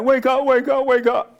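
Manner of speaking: high, loud mock shouting; frantic wake up. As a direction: shake him awake in a hammed-up panic. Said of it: "They're like".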